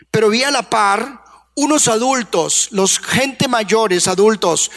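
ordinary talk, nothing marked

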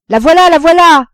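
high, very loud cry of sighting; excited shouting. A narrator's reading. Father sees the liner coming, shouting excitedly. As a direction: yell it out, thrilled. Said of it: "criait toujours Roland".

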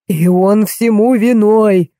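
slow, wailing lament. Acted. Old man wails at the doctor, slow lament. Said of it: "причитал дедушка".

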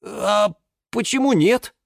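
high-pitched uncertain shrug; tentative. A narrator's reading. Юра looks to the others for support, tentative. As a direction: offer it hesitantly, seeking support. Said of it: "пожал он плечами".

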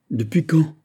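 high, loud startled question; trembling cry. A narrator's reading. Fouquet hears the prisoners are gone, alarmed cry. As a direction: cry out in alarm, pitch jumping up. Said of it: "s'écria Fouquet tremblant".

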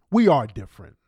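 put-on lightly ape her Kenyan accent on "We are different"; mock Kenyan accent. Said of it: "she's really extra with the accent".

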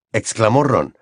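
plain reading voice

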